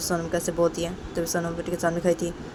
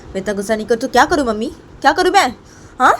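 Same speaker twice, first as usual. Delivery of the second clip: raised, higher-pitched angry protest; 6 semitones higher, 7 dB louder than usual. Acted. Payal protests to her mother-in-law about him, very angry. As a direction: let the anger burst out, loud. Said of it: "मुझे बहुत गुस्सा आ रहा है".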